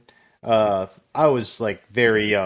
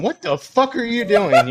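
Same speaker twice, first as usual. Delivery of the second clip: shocked grandpa, laughing; 11 semitones higher, 3 dB louder than usual. Retold or imitated. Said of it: "remember him just going".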